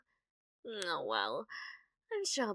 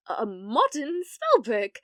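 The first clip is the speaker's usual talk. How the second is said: flustered; 10 dB louder, slower than usual. Acted. Flustered lie about the album.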